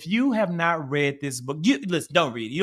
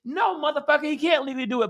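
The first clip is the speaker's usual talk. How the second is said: angry retort; 10 semitones higher, faster than usual. Real, not acted. Angry rebuttal.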